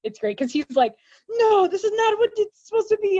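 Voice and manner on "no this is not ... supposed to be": high, wailing mock protest; whining cry. Director wailing that his film turned out hopeful, high mock cry. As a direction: imitate a director crying out in dismay.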